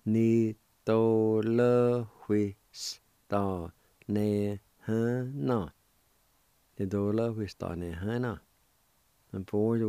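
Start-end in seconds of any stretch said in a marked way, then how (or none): 0.0-5.7 slow, careful syllables; teacher slowly modeling the word; slow demo. Shown as a demonstration. 6.8-8.4 quicker natural run of Ni to le hui s ta ne he na; fluent natural pace; at normal speed. Shown as a demonstration.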